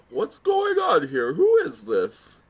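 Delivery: very high, loud mock wail; hammed-up shock. Suan bursting in on the couple, hammed-up shock. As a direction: wail it in put-on hysterics. Acting out Suan's shocked arrival.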